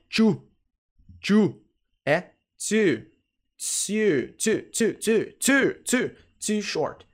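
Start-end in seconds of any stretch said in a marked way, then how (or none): 0.1-1.6 TOO said with a 'ch' onset, like "chu"; mispronounced too. Shown as a demonstration. Teacher shows students the wrong way, TOO as "chu". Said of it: "pronúncia, não é". 2.6-6.6 TOO with a crisp English 't', never 'ch'; correct pronunciation of too. Shown as a demonstration. Teacher drills students on TOO with a clean 't' sound. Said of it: "pronúncia, não é".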